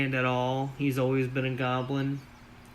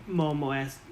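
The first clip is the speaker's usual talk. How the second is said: ordinary talk, nothing marked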